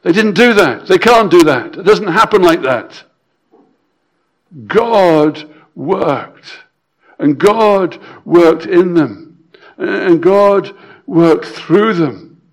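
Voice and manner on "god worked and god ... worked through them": slow stress on God. Acted. Preacher building the point.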